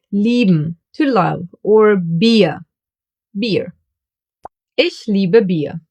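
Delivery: lieben and Bier with a long ee sound; German ie. Shown as a demonstration. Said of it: "more examples for this sound".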